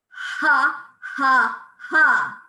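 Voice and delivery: three loud punched belly-laugh has. Shown as a demonstration. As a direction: pump out each ha from the diaphragm. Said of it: "sounds like a good belly laugh".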